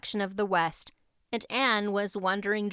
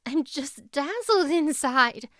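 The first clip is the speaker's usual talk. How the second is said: put-on dazzled girl; 6 semitones higher, 4 dB louder, slower than usual. Anne answers Diana, awed.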